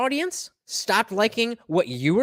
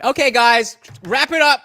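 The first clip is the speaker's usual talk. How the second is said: loud, higher call to shut it down; mock announcer call; 4 semitones higher, 9 dB louder than usual. Telling the podcast to end it, a loud mock call. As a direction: declare the show over, hammed up.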